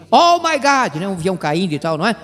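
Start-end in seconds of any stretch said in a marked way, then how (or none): 0.1-1.0 a hammed-up Hollywood gasp of alarm; like a passenger on a falling plane, lightly acted; mock panicked exclamation. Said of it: "a pessoa na interjeição fala assim".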